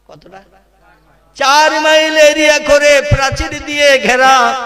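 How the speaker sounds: high, sustained sermon chant; melodic preaching. Acted. Preacher describing the vast Baqi cemetery to the crowd, melodic preaching. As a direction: sing out the description with rousing force.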